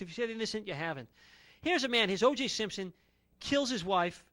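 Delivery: ordinary talk, nothing marked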